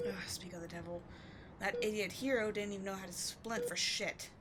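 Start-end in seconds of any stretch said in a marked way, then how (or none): 0.1-1.0 whispered, disgusted aside; annoyed whisper. Acted. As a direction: mutter under your breath in annoyance.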